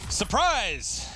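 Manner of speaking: high, loud burst on the reveal; sudden commentator yell; excited shout. Real, not acted. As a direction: shout it like springing an ambush. Calling a sudden ambush.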